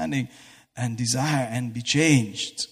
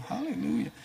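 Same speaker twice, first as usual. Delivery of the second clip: normal talking voice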